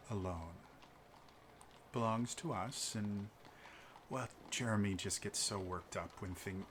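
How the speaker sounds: quiet, low, courteous apology; smooth a diplomatic apology over softly; soft-spoken noble. Acted.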